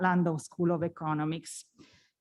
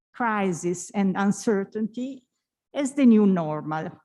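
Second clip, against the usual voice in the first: ordinary talk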